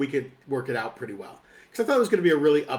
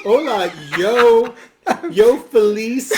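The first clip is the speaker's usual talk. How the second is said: loud, higher, very slow broken Spanish; friend without Spanish; 6 semitones higher, 7 dB louder, much slower than usual. Acted. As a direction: drag out each word like a hammed-up beginner. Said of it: "who didn't speak a word of Spanish".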